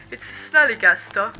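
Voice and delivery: loud, hammed-up British moonshiner; bad British accent. Moonshiner blaming the noise on a monster, bad British accent. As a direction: call it out in a badly faked British accent. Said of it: "bad British accent".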